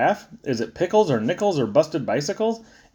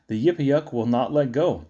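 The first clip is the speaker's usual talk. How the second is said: ordinary delivery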